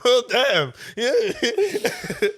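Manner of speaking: high, laughing through words. Real, not acted. Still laughing.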